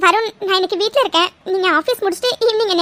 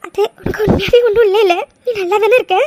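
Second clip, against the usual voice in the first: teary half laugh; sniffling, still crying; laughing through tears. Acted. Girl checking on her fiancé after he asks if she has stopped crying, laughing through tears. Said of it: "அழுகையை நிறுத்தியாச்சா?".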